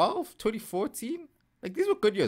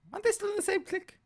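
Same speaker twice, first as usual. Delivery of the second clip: squeaky question; 5 dB quieter, much faster than usual. Acted. Sudden high squeaky question.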